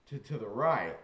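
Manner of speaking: ordinary talk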